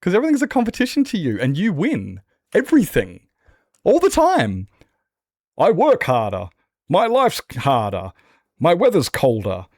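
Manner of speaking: mock their endless one-upping with exaggerated scorn; high, loud, sarcastic list, slowing to the end; sarcastic mocking rant. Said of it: "And it's just like".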